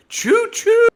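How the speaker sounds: high, loud squeaky alien trader voice; Jawa accent. Acted. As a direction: chirp it like a little desert scavenger. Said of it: "Is that your, is that your Jawa accent?".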